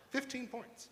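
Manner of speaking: high, hushed echo of FIFTEEN POINTS; mock disbelief. Real, not acted. Host doubting the pollster's lead for the governor, softly incredulous. Incredulous repeat of the poll figure.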